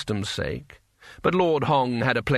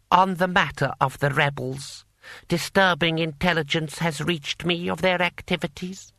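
slightly raised, measured council voice; formal scheming lord; 5 semitones higher than usual. A narrator's reading. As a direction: open grave business before the warlords. Lord Hong addresses the warlords.

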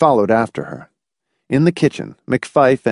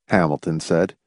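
plain reading voice

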